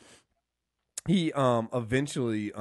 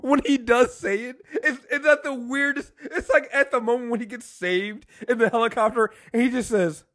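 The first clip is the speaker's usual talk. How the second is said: high, loud telling through laughter; giddy, laughing excitement; 9 semitones higher, 6 dB louder than usual. Real, not acted. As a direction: tell it while cracking up. Cracked up retelling a funny scene.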